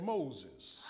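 low, soft parrot reply. A narrator's reading. Parrot answers the burglar, low and soft. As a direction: drop low and quiet for the bird.

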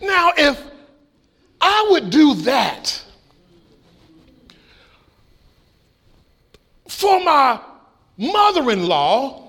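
high, slow, drawn-out preacher's climax; preaching at full force. Acted. Pastor driving home the lesson to the church, preaching at full force. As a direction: build the final point for God and Christ.

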